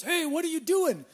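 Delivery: high, loud call to someone up on a roof. Retold or imitated. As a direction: shout up from the street, half amused. Passing painter calls up.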